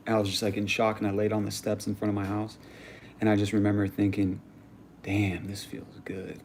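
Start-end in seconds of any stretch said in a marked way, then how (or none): 5.0-6.4 slow, soft, airy murmur; retold blissful relief. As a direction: sink into it as if drifting off. Retold blissful calm after being shot.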